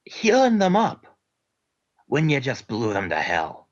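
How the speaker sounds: Scottish brogue with aye and lad; gruff Scottish interrogator, lightly done; Scottish accent. Acted. Interrogator pressing the spy in a light Scottish accent. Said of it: "continues Scottish character".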